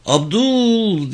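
high, drawn-out call; calling shout. Acted. Calling Abdul through the gate, high shout. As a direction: call out for a friend at the door. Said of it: "diye bağırdı".

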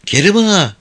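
sweet coaxing call, falling; coy call. Acted. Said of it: "Güldane nazlı nazlı seslendi". (nazlı nazlı seslenerek)

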